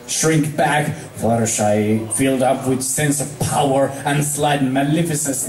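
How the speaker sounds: plain reading voice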